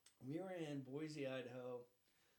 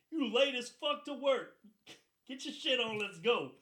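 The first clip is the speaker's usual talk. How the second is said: slow start, loud high-pitched yell; sergeant barking orders; 10 semitones higher, 15 dB louder than usual. Retold or imitated. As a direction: order him out like a fed-up sergeant. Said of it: "He's like".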